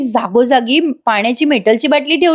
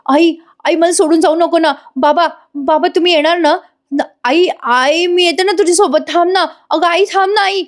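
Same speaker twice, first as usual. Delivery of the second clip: pleading sleep-talk, higher and calling out; dream shouting; 6 semitones higher than usual. Acted. Priya calls for her mother in her nightmare, pleading. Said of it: "ती मोठ्या मोठ्याने आरडाओरडा करत असते".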